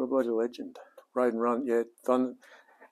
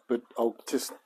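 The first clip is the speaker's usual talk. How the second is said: ordinary talk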